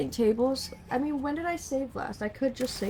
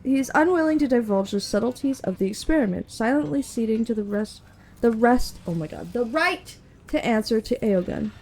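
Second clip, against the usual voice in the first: steady, measured scene-setting read; game master narration; 4 dB louder than usual. Acted.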